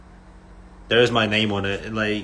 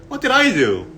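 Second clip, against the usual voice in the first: high-pitched mock-innocent protest; acted-out bewildered question; baffled protest; 9 semitones higher than usual. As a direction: retell it as a wronged, puzzled friend. Acts out his baffled protest.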